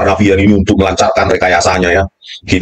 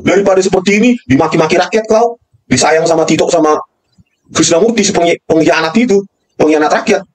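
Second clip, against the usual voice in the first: heated ranting, pitch pushed higher; angry rant; 8 semitones higher than usual. Real, not acted. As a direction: hurl accusations at the police chief, voice climbing. Ranting at the police chief. (marah berapi-api dengan nada tinggi)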